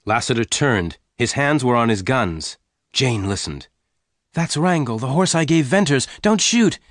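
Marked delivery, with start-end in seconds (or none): 4.3-6.8 high, urgent warning rising to the end; crying out. A narrator's reading. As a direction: build to an alarmed plea. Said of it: "she cried".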